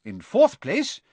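lightly voiced genial elderly speechmaker; hint of a jovial old orator; cheerful old headmaster. A narrator's reading. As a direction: address the hall with measured ceremony. Narrator voices headmaster's feast speech.

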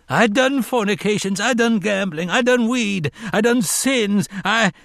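very high, frantic confession; hysterical wail. A narrator's reading. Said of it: "voice rose skyward in a dreadful, hysterical wail".

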